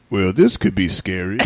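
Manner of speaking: low, slow, laughing deadpan; deadpan guy in the room. Retold or imitated. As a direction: drawl it out low and slow, amused. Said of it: "he goes".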